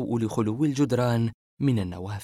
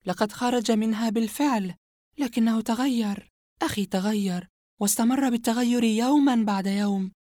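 higher, cold female villain confessing; Yumna's voice; 10 semitones higher than usual. Acted. Yumna confessing her brother's story to Asem, cold and high. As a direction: calmly tell the dark tale, a sinister woman.

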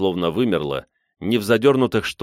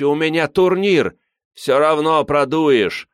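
high, raised pleading and jeering; heated high-pitched heckling; shouted crowd bickering; 8 semitones higher, 4 dB louder, slower than usual. A narrator's reading. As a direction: shout over a noisy mob. Crowd bickering over seats.

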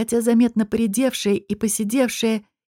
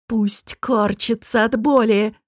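usual speaking voice

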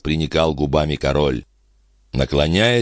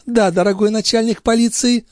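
high feminine retort; insistent countess. A narrator's reading. Countess confirming her demand, high and insistent. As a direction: answer him in a much higher lady's voice. Countess answers the police chief.

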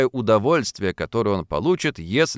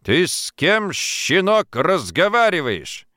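higher, drawn-out indignant rebuke; indignant challenge; 7 semitones higher, slower than usual. A narrator's reading. Drunken timekeeper rebuking a young worker, indignant. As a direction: put the insolent pup in his place.

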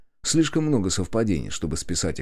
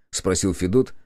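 ordinary delivery

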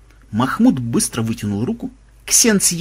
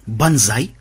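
ordinary delivery, nothing marked

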